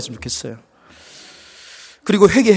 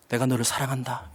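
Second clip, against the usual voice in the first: tender; 7 semitones lower, 14 dB quieter than usual. Acted. God's tender words quoted softly.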